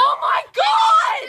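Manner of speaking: loud, slow, high thrilled cry; excited shout. Real, not acted. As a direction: shout it out in delight. Remembering a favourite childhood movie.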